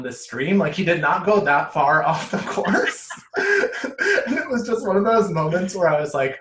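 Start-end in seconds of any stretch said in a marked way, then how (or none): 3.1-4.2 sudden high-pitched laugh; laughing at a friend caught on camera; high burst of laughter. Real, not acted. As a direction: break into a squealing laugh. Laughing at the pee story.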